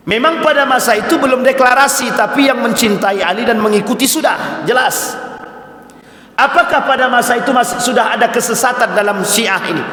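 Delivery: high, urgent argument from the pulpit; full-force preaching. Real, not acted. Preacher arguing to congregation about early followers of Ali, fervent.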